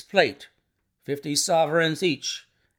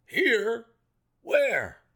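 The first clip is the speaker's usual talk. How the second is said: high, startled short questions; amazement; 9 semitones higher, 3 dB louder than usual. A narrator's reading. Colonel told the murderer is here, amazed. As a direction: fire back in astonished disbelief. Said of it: "stared at him in amazement".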